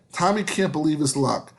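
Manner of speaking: ordinary delivery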